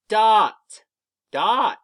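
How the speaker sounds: "Dot" blended into one loud word; said fast. Shown as a demonstration. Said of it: "when we say it fast".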